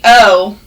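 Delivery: "oh." as a long round o; long oh sound. Shown as a demonstration. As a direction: say "oh." with a pure o vowel. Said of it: "O W can also say".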